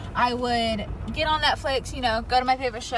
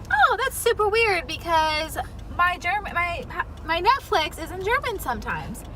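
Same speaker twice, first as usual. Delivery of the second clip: retold surprise; 6 semitones higher than usual. Sister retelling her reply to brother, mildly surprised. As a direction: act out puzzled surprise, pitched up.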